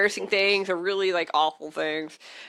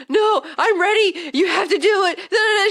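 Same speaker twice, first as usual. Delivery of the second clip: high, loud melodramatic declaration; movie monologue; 12 semitones higher, 5 dB louder than usual. Retold or imitated. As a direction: declaim it like a hammed-up film heroine. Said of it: "like, this movie monologue".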